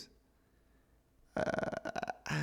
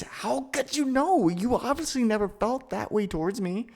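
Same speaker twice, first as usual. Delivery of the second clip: hurt protest; 11 semitones higher than usual. Acted.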